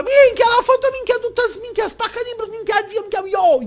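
high, loud hyped slang shouts; hyped yelling. Retold or imitated. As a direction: yell like a hyped street kid.

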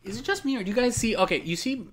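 excited high disbelief. Real, not acted. Noticing glitch on screen.

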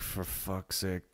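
drawn out slowly and softly under the breath; slow exasperated mutter at the broken pump; fed-up groan. Retold or imitated. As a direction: let the frustration sag out slow. Pump fails again, exasperated.

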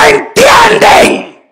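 loud, high shouting sermon with a crying edge; fiery preaching. Acted. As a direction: thunder it at the congregation. Preacher at full force.

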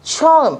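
처음 with a strong puff of breath on the first sound, high; aspirated demo. Shown as a demonstration. Teacher showing aspiration in 처음. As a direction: push air on 처음. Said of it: "đọc bật hơi nhá".

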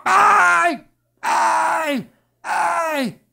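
high, loud wail of pain; repeated high shrieks, mimicking a possessed woman; long screamed cries; imitated screams. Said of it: "E a mulher gritava".

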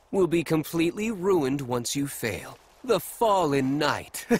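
ordinary delivery

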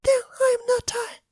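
high, soft tearful voice; crying Elsa. Acted. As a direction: whimper it out, close to tears. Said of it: "and crying".

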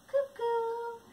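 high cuckoo call; mock cuckoo. Shown as a demonstration.

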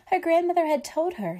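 quick, lifted refrain; brisk refrain. A narrator's reading. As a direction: rattle off the familiar refrain. Repeated refrain read to children.

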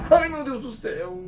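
high startled gasp of fear; frightened cry. Real, not acted. Player scared by the creepy room, startled high cry. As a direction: yelp it out, genuinely spooked. Scary room in horror game.

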